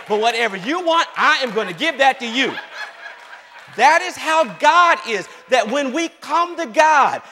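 loud, high, emphatic sermon delivery; full-force preaching. Real, not acted. Preacher likening God to a generous father, full-force preaching. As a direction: drive the promise home to the congregation.

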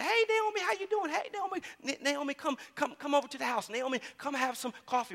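high, bright sing-song greeting calling Naomi; cheery neighbors calling. Retold or imitated. Neighbors calling Naomi over for coffee, high and cheery. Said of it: "they say".

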